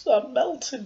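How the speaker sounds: high melting witch wail; Wicked Witch of the West. Acted. Quoting The Wizard of Oz to the viewers, high witch wail. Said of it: "the Wicked Witch of the West".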